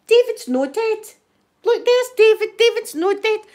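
high, loud retold protest; mock disbelief. Housemates finding the man alive in bed, mock disbelief. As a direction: act out astonished housemates, pitched right up.

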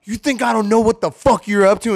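angry retold yell. Roommate confronting her about the cereal, yelling angrily. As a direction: she catches her roommate out and is furious. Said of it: "And she's mad".

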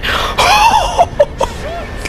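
long, very high-pitched whoop; high squeal. Pretend. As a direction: let out a drawn-out falsetto squeal. Mock alarm at shirtless game character.

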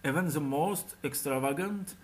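ordinary delivery

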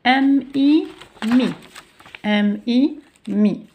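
loud, drawn-out "M, I", blended into "mi"; syllable sounding-out. Shown as a demonstration. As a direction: spell out "M, I", then land firmly on "mi". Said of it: "ونقرأ في نفس الوقت".

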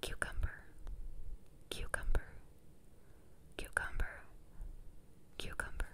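spaced-out whispers, close to the ear; whispered test word. Pretend. Doctor testing which ear hears the word, whispering. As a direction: whisper the word, leaving long gaps. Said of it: "I'm gonna whisper something to you".

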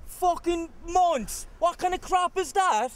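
outraged shout. Acted. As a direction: explode in disbelief. Boyfriend outraged by rival's affair.

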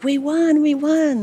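high, loud cheer of victory; retold jubilant shout; excited shout. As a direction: crow about the win to a coworker. Insisting on the football win.

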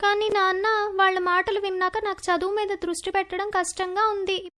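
put-on upset young daughter. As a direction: plead with dad like a hurt schoolgirl. Hurt daughter objects.

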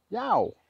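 sudden high-pitched "yow"; real cry of pain, very high; pained yelp. Real, not acted. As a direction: yelp as the blade nicks you. Cuts himself with a knife.

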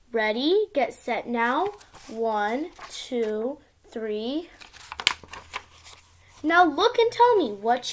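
sing-song storybook reading; reading to a toddler. Acted. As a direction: read it like a bedtime picture book. Child reads picture book aloud.

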